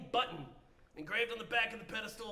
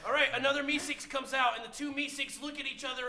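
ordinary delivery

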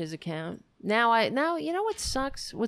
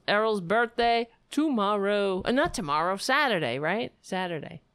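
ordinary delivery

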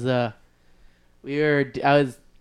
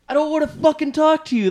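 retold angry yell; 11 semitones higher than usual. Refusing to talk to the producer, in a retold angry yell. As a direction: shout that you're done with him. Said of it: "I was like".

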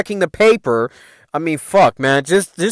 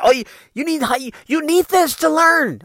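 mocking the adults; 11 semitones higher than usual. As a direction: mimic a nagging teacher, pitched way up. Mimicking adults who insisted on school.

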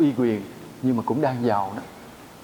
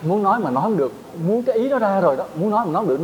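higher, straining to get the words out; mock stammer struggle; 7 semitones higher, faster than usual. Shown as a demonstration. Monk mimics a stutterer to lay disciples. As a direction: act out a stutterer stuck on a word. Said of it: "nó giống như cái mạch nó bị chập á".

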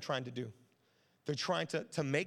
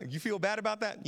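louder, higher probing question; pressing parent; 5 semitones higher, 6 dB louder than usual. Retold or imitated. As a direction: press the kid for an answer. Parent pressing son, loud.

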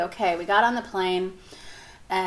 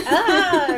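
panicked yelp; 10 semitones higher, 7 dB louder than usual. Retold or imitated. Panicked stuck in seatbelt.

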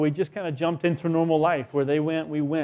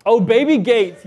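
realizing; 6 semitones higher, 10 dB louder than usual. Real, not acted. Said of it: "You, baby games".

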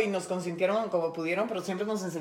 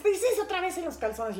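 scolding mother; 9 semitones higher than usual. Retold or imitated. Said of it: "y dice".

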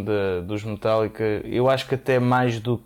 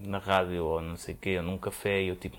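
ordinary delivery

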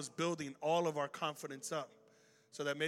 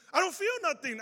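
high, loud imitation of a thrilled kid; amazed excitement; 13 semitones higher, 6 dB louder than usual. Healed kid tells the pastor about his back, high and amazed. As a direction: announce it in acted wonder.

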